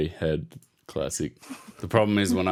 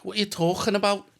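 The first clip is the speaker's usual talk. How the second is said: high-pitched put-on Scouse accent; bad Scouse accent; 13 semitones higher than usual. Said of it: "have Scouse accents if you don't want".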